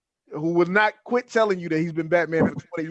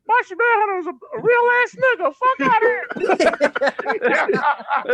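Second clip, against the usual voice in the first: put-on very high kid's squeal, slowing at the end; squeaky kid voice; 14 semitones higher, 4 dB louder, slower than usual. A kid in the corner defends the Martian Manhunter, squeaky and high. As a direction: squeak it like a cocky little kid. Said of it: "a kid over in the corner".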